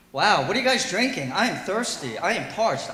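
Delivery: ordinary talk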